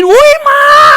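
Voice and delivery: high, loud shriek of fright; shrieking woman. Retold or imitated. Woman opening the toilet door shrieks at the cockroach, high and loud. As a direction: scream like you saw a cockroach. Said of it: "એવી બૂમ પાડીને".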